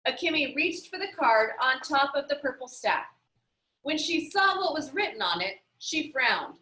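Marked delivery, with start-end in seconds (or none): none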